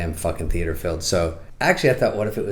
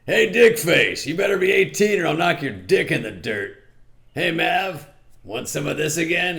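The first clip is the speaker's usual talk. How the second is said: tough bouncer; 10 semitones higher than usual. Retold or imitated. Comic voicing Kelly McGillis as a brash bouncer. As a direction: bark it like a rowdy bouncer. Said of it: "she's the bouncer".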